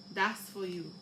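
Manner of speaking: usual speaking voice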